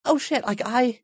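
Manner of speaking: high, soft mock shock; retold stunned realisation. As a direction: act out a hushed, high-pitched dawning realisation.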